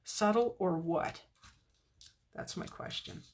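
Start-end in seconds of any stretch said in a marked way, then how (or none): 2.3-3.2 breathy whispered aside; whispering. Real, not acted. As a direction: whisper it to yourself. Unvoiced aside to viewers.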